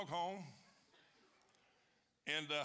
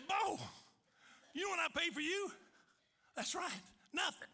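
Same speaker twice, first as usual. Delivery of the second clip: gleeful praise to dog; 11 semitones higher than usual. Retold or imitated. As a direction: gush at the dog in triumph. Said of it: "I said".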